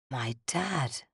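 very soft, half-whispered. A narrator's reading. Narrator realizing the truth, a soft near-whisper. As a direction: breathe the words out barely audible. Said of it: "I whispered".

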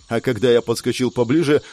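ordinary delivery

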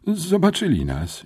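high-pitched wail of dismay; moaned dismay. A narrator's reading. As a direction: moan it high, as if just caught. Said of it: "jęknąłem".

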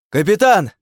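high, loud yell of alarm; frantic cry. A narrator's reading. Crewman seeing the captain about to shoot himself, frantic cry. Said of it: "истошно крикнул кто-то".

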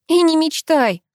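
high-pitched, indignant refusal; cross. A narrator's reading. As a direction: shut him down crossly. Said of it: "рассердилась я".

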